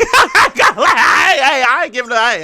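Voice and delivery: high, loud laughing protest; words breaking into loud laughter. Real, not acted. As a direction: crack up while trying to speak. Cracking up at own joke.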